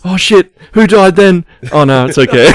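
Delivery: loud, high panic easing into relief; mock alarm. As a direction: imitate a startled viewer, then settle. Acts out housemate's alarm at deaths.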